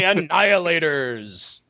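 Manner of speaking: slow drawn-out announcer voice; monster truck ad. Retold or imitated. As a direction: stretch it like a rally promo. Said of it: "monster truck announcer".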